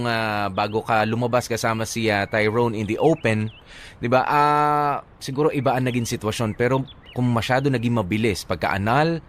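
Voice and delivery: usual speaking voice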